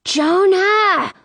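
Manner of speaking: high, strained hushed yell; whisper-yell. A narrator's reading. Sister calling runaway brother, whisper-yelling. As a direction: call out urgently while trying to stay hidden. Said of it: "I whisper yell".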